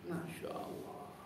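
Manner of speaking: hushed wonder at the preacher; whispered Masya Allah full of awe; awed whisper. Real, not acted. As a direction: breathe it out in a whisper. Awed by the sermon.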